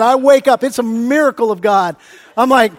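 usual speaking voice

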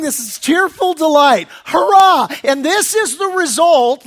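normal talking voice

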